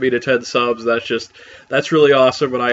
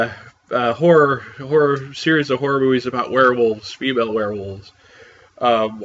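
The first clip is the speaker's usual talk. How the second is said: normal talking voice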